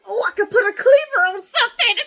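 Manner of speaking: high, loud, quick burst of glee; real excitement spilling over; excited. Real, not acted. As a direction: blurt it out, thrilled. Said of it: "I got excited".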